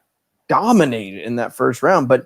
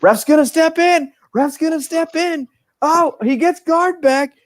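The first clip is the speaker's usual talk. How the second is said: high-pitched excited commentator yell; screaming announcer; 3 dB louder, slower than usual. Retold or imitated. Fight fan mimicking the announcer's call, screaming. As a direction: imitate a frantic fight announcer, pitch shooting way up. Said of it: "the announcer's screaming".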